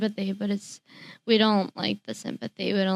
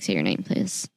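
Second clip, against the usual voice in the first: low mock request; 13 semitones lower than usual. Mock request to new acquaintance.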